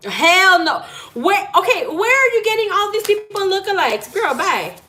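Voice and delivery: high, loud disbelief at the cards; incredulous. Real, not acted. She reacts to the reading, shocked and incredulous. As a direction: squeal it out like you can't believe it.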